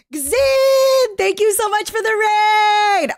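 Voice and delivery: high, loud, slow overjoyed thanks; real excited shouting thank-you. Real, not acted. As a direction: yell your gratitude to the raider. Streamer gets raid, shouts thanks.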